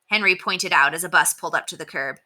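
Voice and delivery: ordinary delivery, nothing marked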